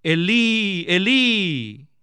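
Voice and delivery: restrained quoted outcry; mild imitated cry. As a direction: lightly act out Jesus calling to God. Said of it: "Jesús clamó a gran voz".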